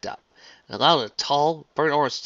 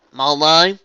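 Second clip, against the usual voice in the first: startled; 6 semitones higher, 14 dB louder than usual. A narrator's reading. Applejack startled.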